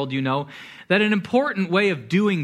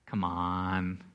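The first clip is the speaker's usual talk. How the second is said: very low, soft coaxing; tempting voice of culture; 11 semitones lower, 10 dB quieter than usual. Acted. Culture tempting believers, low soft coaxing. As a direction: coax temptingly, dropped way down low.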